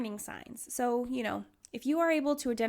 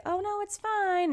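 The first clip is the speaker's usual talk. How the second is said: fake cheerful brush-off; 10 semitones higher, 4 dB louder than usual. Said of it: "don't pretend and be like".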